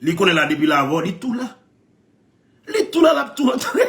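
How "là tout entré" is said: ordinary talk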